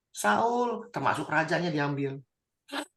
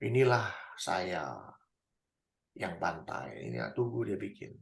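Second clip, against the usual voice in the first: proud murmur; 10 semitones lower, 10 dB quieter, slower than usual. Acted. Voicing Saul's boastful monument.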